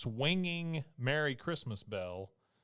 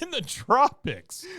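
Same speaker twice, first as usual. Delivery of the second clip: incredulous; 13 semitones higher, 10 dB louder, slower than usual. Real, not acted. Amused echo.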